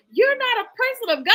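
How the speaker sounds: high, loud mock accusation; mock condemning. Commenter turning on the reader, mock condemning. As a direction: imitate a commenter denouncing you.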